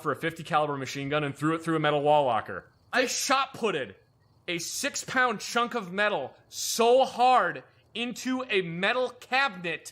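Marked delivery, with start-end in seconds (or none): 2.9-9.9 slow, high-pitched stress on "shot putted" and "so hard"; heavy emphasis. Real, not acted. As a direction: draw out "shot putted" and "metal cabinet" for effect. Emphatic retelling of a violent throw.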